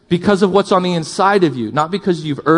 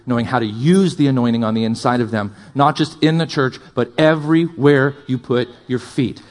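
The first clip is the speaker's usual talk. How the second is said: ordinary talk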